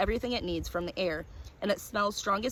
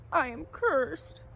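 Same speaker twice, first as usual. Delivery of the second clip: very slow, higher, hollow despair; doomed; 7 semitones higher, much slower than usual. A narrator's reading. Cursed collector tells the stranger his fate, slow and doomed. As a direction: drag out the confession like a doom. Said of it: "he said".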